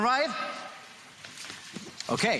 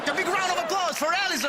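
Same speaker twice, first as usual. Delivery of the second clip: excited announcer; faster than usual. Real, not acted. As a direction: whip up the crowd for Alison. Magician cues applause for volunteer.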